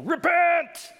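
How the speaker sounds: high ringing cry of repent; prophet's shout. Acted. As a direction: call out like a wild desert prophet. Voicing John the Baptist.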